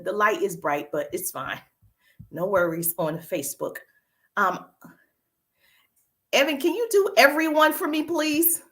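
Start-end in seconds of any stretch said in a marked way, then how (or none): 6.3-8.6 raised-pitch request to a helper; calling out. Real, not acted. Host asks a helper mid-stream, calling out. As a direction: call over to someone helping you.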